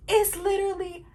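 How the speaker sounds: high, loud: Cinema; excited exclaim. Real, not acted. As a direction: call out Cinema in delight. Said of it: "exclaiming delight".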